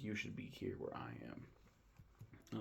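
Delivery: slow, breathy whisper of a disturbed patient; retelling the movie, Lenny strapped to a table, slow creepy whisper. Retold or imitated. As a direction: whisper it slowly and eerily.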